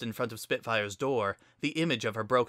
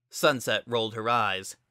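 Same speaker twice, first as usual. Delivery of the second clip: usual speaking voice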